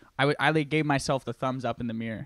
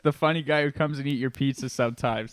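usual speaking voice

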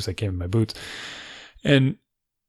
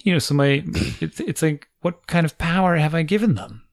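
normal talking voice

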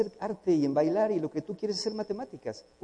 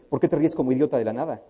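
scolding parent; 8 dB louder, faster than usual. Retold or imitated. Parent scolding laughing child.

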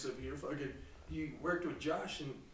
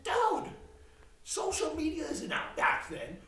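excited yell; 13 semitones higher than usual. Retold or imitated. Telling his stepdad he'd happily take the money, excited yell. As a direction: shout it like the deal's too good to pass up. Retelling his own eager reply.

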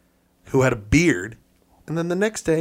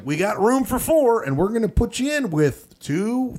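higher-pitched, chirpy seating patter; kitschy dining host; 7 semitones higher than usual. Retold or imitated. Dining car host seats a solo traveller, kitschy patter. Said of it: "one of these kitschy things where it's like".

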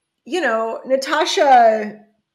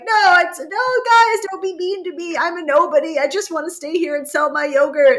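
whiny mock plea; 6 semitones higher than usual. [name] voiced pleading when voted off.

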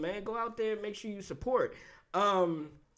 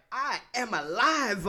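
with conviction; 4 semitones higher, 3 dB louder, slower than usual. Retold or imitated. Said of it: "you hear the conviction in your voice".

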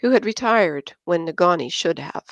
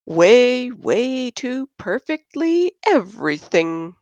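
higher, slow sarcastic drawl; teasing; 6 semitones higher, slower than usual. Acted. Josh mocks Christine's perfect boyfriend, slow sarcastic drawl. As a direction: stretch it out mockingly.

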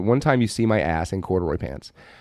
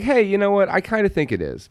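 excited; 9 semitones higher than usual. Retold or imitated. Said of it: "And I was like".

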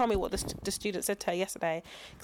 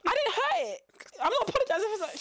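pitched way up, defiant and insistent; high defiant retort; 12 semitones higher than usual. Retold or imitated. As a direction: fire it back high, refusing to give in. Retold defiant quarrel with manager.

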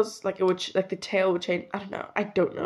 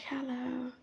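tiny whispered hello; shy whisper; 5 semitones higher, 14 dB quieter than usual. Retold or imitated. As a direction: whisper it shyly. Child greeting Santa shyly.

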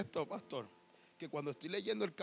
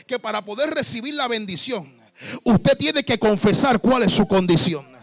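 preaching at full force; 3 semitones higher, 19 dB louder than usual. Real, not acted. Preacher voicing the master's demand, shouting. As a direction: thunder it from the pulpit.

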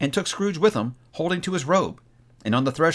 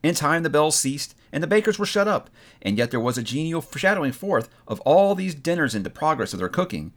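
ordinary delivery, nothing marked

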